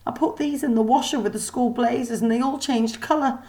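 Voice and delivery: high, shaky-sounding robot maid voice; a sniffling servant caught crying; the maid apologizes, upset and flustered; tearful mechanical maid. Acted. Molly's panicked lament.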